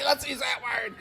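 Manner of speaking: ordinary delivery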